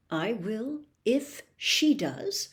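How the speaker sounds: slow, jaw clenched; through gritted teeth. A narrator's reading. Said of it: "said Harry through gritted teeth".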